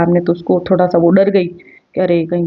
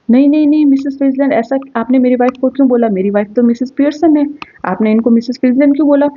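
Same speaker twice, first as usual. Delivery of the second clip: agitated protest; 5 semitones higher, faster than usual. Acted. Confused husband protesting loudly.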